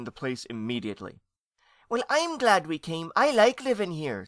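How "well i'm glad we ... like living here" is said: put-on high, loud little-boy voice; youngest ghost child. A narrator's reading. Youngest nephew speaking up for America, childlike.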